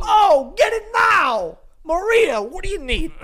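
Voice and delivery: loud, high hammed-up Italian-American yelling; mock Italian shout. Parodying an Italian household calling to Maria, hammed-up shouting. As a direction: yell across the kitchen like a caricature Italian.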